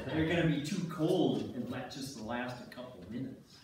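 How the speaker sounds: ordinary talk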